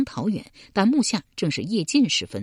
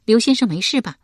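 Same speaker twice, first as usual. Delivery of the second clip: 佩娘问话; 5 semitones higher, 6 dB louder, faster than usual. A narrator's reading. 佩娘关切问刘玲.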